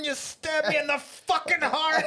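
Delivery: loud, high, slow hammed-up fury; acted betrayed shouting. Film fan playing the boss betrayed like by a son, shouting. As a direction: wail the betrayal like an overwrought movie boss. Acting out betrayed boss's outburst.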